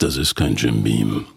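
very low-pitched voiceover delivery; ad-spot bar customer. Acted. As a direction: sink deep down on the brand name. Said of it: "und er trinkt und er sagt".

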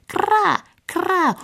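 sharp high kra cries; high caw. Acted. Raven caws over the village, high squawk. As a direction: squawk like a crow. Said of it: "der Rabe krächzte".

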